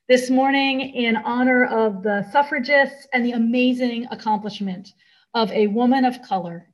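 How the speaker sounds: ordinary talk, nothing marked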